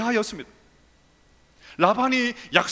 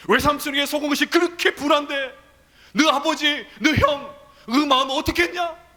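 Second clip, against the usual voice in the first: higher, louder solemn rebuke; God scolding Jacob severely; rising louder reproach, growing higher; stern rebuke of God; 6 semitones higher, 4 dB louder than usual. Acted. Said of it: "하나님의 엄중한 책망의 소리가 들립니다".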